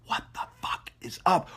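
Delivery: breathy hushed whisper. Acted. Host greeting his viewers in a sudden whisper. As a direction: drop to a secret whisper.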